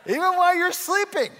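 loud, high-pitched preacher's shout; playful shout. Real, not acted. Repeats it louder after baby reacted.

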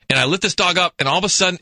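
ordinary talk, nothing marked

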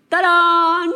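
high, loud "ta-da"; playful fanfare. Acted. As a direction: belt it out like a triumphant reveal. Playful fanfare punctuating her point.

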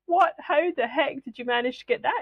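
high-pitched mock astonishment; retold incredulity. Retelling her astonishment at friend's success.

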